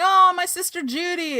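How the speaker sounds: high-pitched mock delight at a sister; excited greeting. Acting Amelia greeting her sister.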